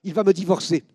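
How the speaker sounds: high, loud blurted complaint; angry outburst. Retold or imitated. Wife complaining to the officer, angry and loud. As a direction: blurt it out in anger. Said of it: "de colère".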